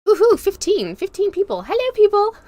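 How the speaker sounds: high, loud whoop of delight; excited cheer. Real, not acted. Streamer cheering fifteen viewers on her live, excited.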